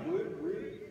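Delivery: imitating a startled, disbelieving jump up high; mock surprise. Mimicking young men hearing they're beautiful, mock surprise. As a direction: play the boys caught off guard.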